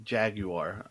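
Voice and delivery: "Jaguar" softly as JAG-you-ar, rising; British pronunciation tried out. Shown as a demonstration. Trying the looked-up pronunciation, "Jaguar" as soft JAG-you-ar. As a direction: test "Jaguar" quietly in three syllables, JAG-you-ar. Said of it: "British pronunciation of Jaguar".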